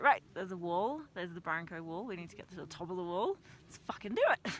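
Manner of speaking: loud, raring to go at the wall; rally yourself like a charge is coming; pumped up. Retold or imitated. Hiker psyching herself up at the wall, loud and eager.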